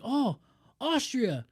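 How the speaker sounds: hammed-up Australian bloke; a cheesy imitation of an Australian; Australian accent. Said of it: "And he's like".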